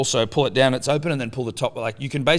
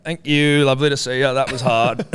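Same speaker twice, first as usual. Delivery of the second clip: relieved dad; 4 semitones higher, 5 dB louder than usual. Retold or imitated. Dad relieved when mother gets home.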